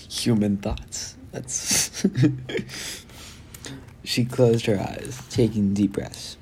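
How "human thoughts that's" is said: giggling through the words; cracking up mid-line; laughing. Real, not acted. Reader breaks into laughter.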